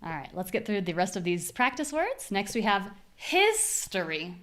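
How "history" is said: exaggerated strong start on "history"; first-syllable stress. Shown as a demonstration. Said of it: "So let's use our tools".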